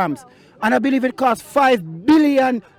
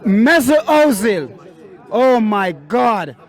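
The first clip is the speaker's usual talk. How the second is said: slow, drawn-out disbelief at the name; exasperated; 3 semitones lower, much slower than usual. Real, not acted. Fan despairing at a player's display, slow and exasperated. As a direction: let the name hang in dismay.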